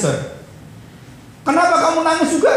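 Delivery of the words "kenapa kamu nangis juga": high, loud retold question; imitated astonished question. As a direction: ask it in high-pitched surprise. Retelling friend's question amid crying.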